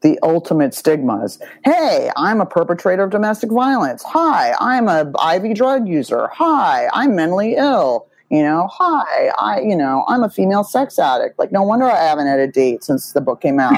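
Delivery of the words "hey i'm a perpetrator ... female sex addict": high, swooping perky greeting; sing-song self-mocking hello; mock cheery introduction. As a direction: introduce yourself with exaggerated brightness. Mock self-introductions with stigmatized labels.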